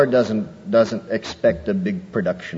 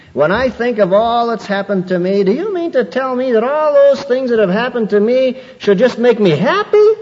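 much higher, louder aggrieved whine; mock indignant complaint; 11 semitones higher, 5 dB louder than usual. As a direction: parody the wronged churchgoer.

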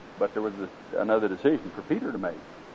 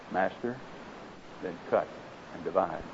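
much softer and lower, quiet yielding; hushed surrender; 7 semitones lower, 11 dB quieter than usual. Acted. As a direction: let go in a hushed final yes. Bamboo's final surrender, hushed.

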